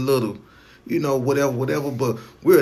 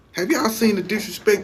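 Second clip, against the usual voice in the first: incredulous high repeat; 10 semitones higher than usual. Real, not acted. Repeats question louder, higher.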